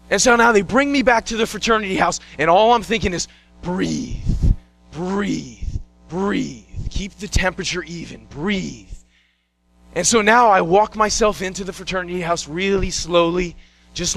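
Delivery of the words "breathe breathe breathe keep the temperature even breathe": low, soft slow breaths of words; calming self-talk. Retold or imitated. Cramping athlete coaching himself to breathe, low and hushed. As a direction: talk yourself down quietly, willing the body calm.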